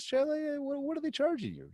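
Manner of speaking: high, disbelieving question; incredulous. Retold or imitated. Asking the client in high disbelief.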